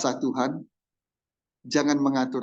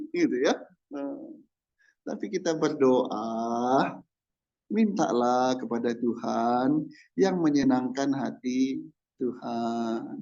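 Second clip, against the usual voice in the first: ordinary talk, nothing marked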